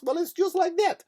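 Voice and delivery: mock Russian accent leaping high at the end. Comedian replays the nurse's comparison, mock Russian accent. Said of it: "here's what I noticed about the Russian accent".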